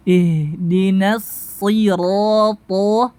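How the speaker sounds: slow recited IHDINA SIRO, heavy TO; thick ta. Shown as a demonstration. Teacher leading practice, slow recitation with thick letters. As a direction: draw out SIRO into a dark, thick TO. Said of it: "to juga tebal".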